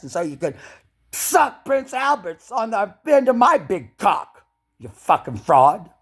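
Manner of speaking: loud, high jeering yell; shouted furious mockery; furious taunting. Real, not acted. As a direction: taunt the prince with rage. Taunting Prince William angrily.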